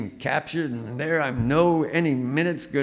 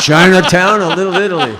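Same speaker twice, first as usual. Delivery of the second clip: loud, laughing punchline shout; a laughing yell of the chefs' answer; laughing mock shout; 9 semitones higher, 15 dB louder than usual. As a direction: blurt it out through laughter. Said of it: "it's like".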